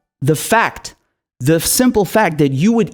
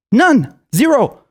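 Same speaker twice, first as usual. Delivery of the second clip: punchy emphatic; 7 semitones higher, slower than usual. Real, not acted.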